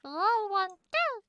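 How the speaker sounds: squeaky high chipmunk voice. Pretend. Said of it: "you sound like a chipmunk".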